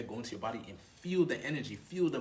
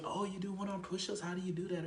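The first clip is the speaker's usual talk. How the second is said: put-on higher-pitched girl asking questions; lightly acted woman's voice, raised; interested girl; 7 semitones higher than usual. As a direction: imitate her curious reply, pitched up. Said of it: "She may, she may input".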